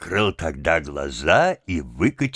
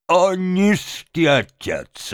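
high, drawn-out outcry; outraged reproach; 10 semitones higher, slower than usual. A narrator's reading. Benchik answers his father, outraged reproach. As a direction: cry out in slow indignation.

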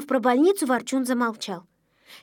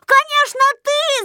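high, loud accusing cry; indignant shout; 13 semitones higher, 5 dB louder than usual. A narrator's reading. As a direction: shout the blame as a whole crowd would. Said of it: "закричали тут все". (возмущённый высокий крик)

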